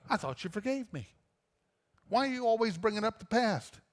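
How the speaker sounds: high, put-out protest; a debtor to his friend, put out and indignant; indignant whine. Acted. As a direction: act wounded that it's brought up again.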